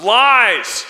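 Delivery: sudden high, booming shout. Acted. Devil hurling falsehood at believers, full shout. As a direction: bellow the word as the devil. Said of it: "The devil shouts".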